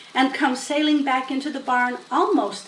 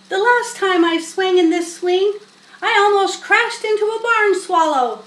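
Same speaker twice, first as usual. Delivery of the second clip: higher, louder boastful yell from the hayloft; 8 semitones higher, 6 dB louder than usual. A narrator's reading. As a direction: call it down loudly, showing off. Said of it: "he yelled".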